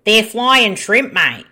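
loud, slow ocker Aussie drawl on flying; hamming up an Aussie bloke; mock Aussie ocker. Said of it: "we're a bit Aussie ocker today".

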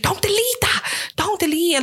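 high, slow, pleading don't; urgent friend. Retold or imitated. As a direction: stretch it out high, begging her to keep it. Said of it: "She was like".

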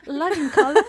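hammed-up British accent redo; British accent again, a bit forced. Shown as a demonstration. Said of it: "Refais-le".

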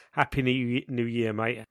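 usual speaking voice

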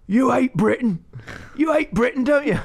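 loud, very high-pitched heckler's yell; retold shout. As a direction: imitate a bloke yelling across the road. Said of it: "some bloke shouted out of the road".

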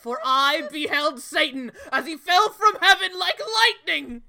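mock scream. Quoting Bison's screamed line.

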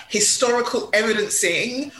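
ordinary talk, nothing marked

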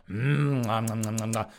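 hungry "mm" into nom-noms on one note; mock munching noises. Mimicking a teacher tempted by students, mock munching. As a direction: imitate greedily gobbling food. Imitates ogling as hungry eating.

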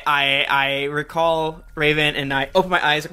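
ordinary talk, nothing marked

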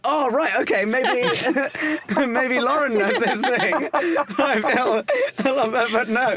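high, giggly and breaking into laughter; real giggles bubbling through the lines; laughing. Real, not acted. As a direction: laugh through the words at the mix-up. Laughing at her own mix-up.

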